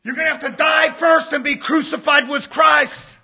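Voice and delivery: relentless pulpit cry; full-force preaching. Real, not acted. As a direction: drive each point home to the congregation.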